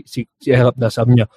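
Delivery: ordinary talk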